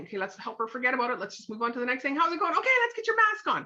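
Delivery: hammed-up perky cover-up; forced cheer. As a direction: rush past it with a chipper smile. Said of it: "the girl in me before".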